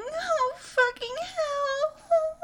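very high, drawn-out wail; peak of climax. Acted. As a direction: stretch it out at the top.

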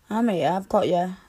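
ordinary talk

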